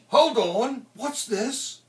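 high-pitched surprised character voice; acted sudden curiosity; startled Teddy Boy. A narrator's reading. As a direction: stop short in surprise, pitch jumping up. Teddy Boy spots the records.

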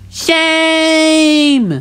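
high, drawn-out heckle; long high shout of outrage; indignant cry. A narrator's reading. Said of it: "cried Percy".

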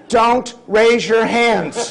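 loud, high, very slow comic warning; mock-alarmed holler, word by word. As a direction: stretch out a hammed-up warning to the crowd.

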